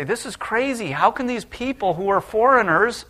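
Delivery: high, rising to loud disbelief; incredulous exclaim. Acted. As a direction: sound amazed at the foreigners. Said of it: "as you read the story, say".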